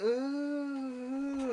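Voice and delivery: long, high mock-tipsy ooh; wobbling ooh. Tipsy passenger on the stairs, mock-unsteady. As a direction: imitate losing balance with a drawn-out ooh.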